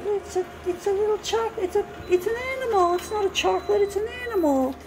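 put-on wide-eyed child insisting the chocolate is an animal; child's little voice. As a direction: speak like a worried little kid. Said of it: "in their little voice".

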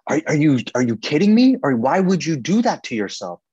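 mock the father exploding at his son; imitating furious dad. Father erupting at his son over the fast, loud imitation. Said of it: "my dad just erupts in this, like, explosion".

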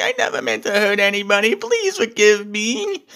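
high, whiny fake sob in a put-on voice; mock crying. Alexa Bliss fake-begging Nia Jax for forgiveness, mock sobbing. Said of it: "Alexa Bliss starts crying".

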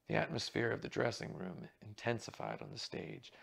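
ordinary delivery, nothing marked

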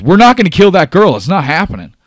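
fast, high shouted refusal; losing his mind. Acted. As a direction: shout them down, absolutely refusing. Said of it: "losing his mind right now".

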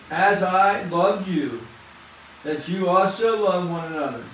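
solemn pulpit reading of John; scripture reading. Acted. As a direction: read the verse reverently, word by word.

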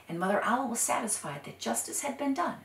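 ordinary delivery, nothing marked

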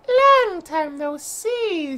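high, slow, drawn-out character voice; put-on character voice. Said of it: "I need to put my ma, ma, ma s-, voice on".

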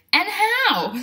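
high, loud exclamation from a cow; lightly voiced cow, calling out high and loud; cow character. A narrator's reading. Cow reacting to the new baby, exclaiming. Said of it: "said the cow".

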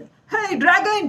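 high, slow mock-angry troll; a mild cartoon scolding of the dragons; hammed-up angry troll for children. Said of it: "troll गुस्सा हो जाता है".

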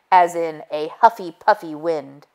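plain reading voice

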